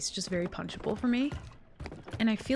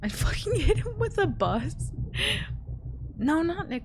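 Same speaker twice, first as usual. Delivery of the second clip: laughing; 9 semitones higher than usual. Real, not acted. Laughs at her own joke.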